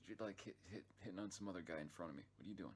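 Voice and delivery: ordinary delivery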